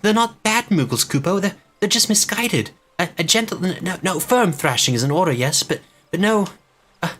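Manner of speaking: high, frantic little moogle begging them to stop; a small creature begging for mercy on its friends; pleading moogle. Acted. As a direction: stammer it out high and panicky, like a fretful moogle.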